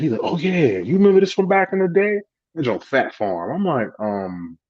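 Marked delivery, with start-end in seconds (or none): 0.2-2.2 high-pitched voice of a delighted father; light up over an old find, retold; imitated nostalgic excitement. Father grabs an old jacket, imitated nostalgic excitement.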